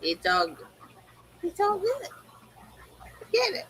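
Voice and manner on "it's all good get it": much higher, small voice, softer; high pet talk. Real, not acted. Owner settling a restless pet, high-pitched pet talk.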